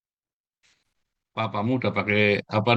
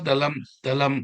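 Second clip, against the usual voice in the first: ordinary talk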